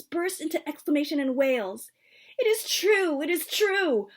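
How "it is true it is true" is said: much higher, louder insistent grandmother; insisting. A narrator's reading. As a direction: hammer the truth home, agitated. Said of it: "my grandmother insisted".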